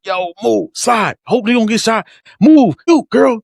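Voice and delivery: high, loud, shouting at the book; hyped reaction. Retold or imitated. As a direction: cheer the characters on like it's live. Retold hype while listening to audiobook.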